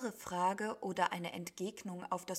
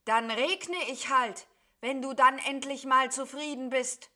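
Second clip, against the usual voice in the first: louder, raised, exasperated giving-in; fed up; 5 semitones higher, 9 dB louder than usual. A narrator's reading. Cloud beast gives in to nagging sheep, exasperated. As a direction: concede angrily with lifted voice. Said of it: "Da hatte das Wolkentier genug".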